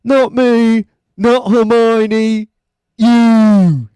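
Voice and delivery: put-on high, loud, slow whiny kid voice; Ron from Harry Potter. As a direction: whine it out like a schoolboy. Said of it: "like, Ron from Harry Potter".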